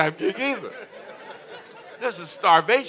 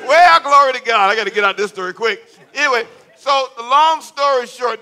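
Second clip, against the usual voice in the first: preaching; 6 semitones higher, 11 dB louder than usual. Real, not acted. Preaching at full force.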